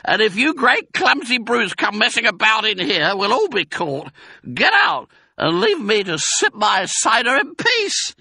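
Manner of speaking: high, loud ratty tirade; shrieked scolding. A narrator's reading. Rat sends the intruders away, shrieking. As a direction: screech at them to clear off. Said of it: "shrieked Rat".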